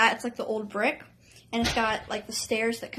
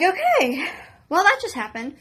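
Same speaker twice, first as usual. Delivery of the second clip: stunned okay; 6 semitones higher, 5 dB louder than usual. Retold or imitated. Stunned reaction retold.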